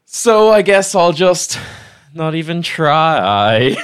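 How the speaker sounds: high and loud through laughter; giggling at own flop; laughing. Real, not acted. Musical improv, laughing.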